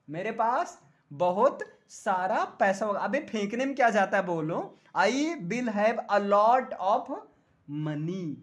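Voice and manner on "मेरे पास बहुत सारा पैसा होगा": full-voiced, measured declaration to the class; emphatic boast. Shown as a demonstration. Same boast repeated for the class.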